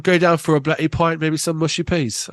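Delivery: clumsy mock-British banter; terrible English accent. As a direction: put on a hammy London pub accent. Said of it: "that was a terrible accent".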